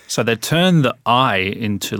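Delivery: ordinary talk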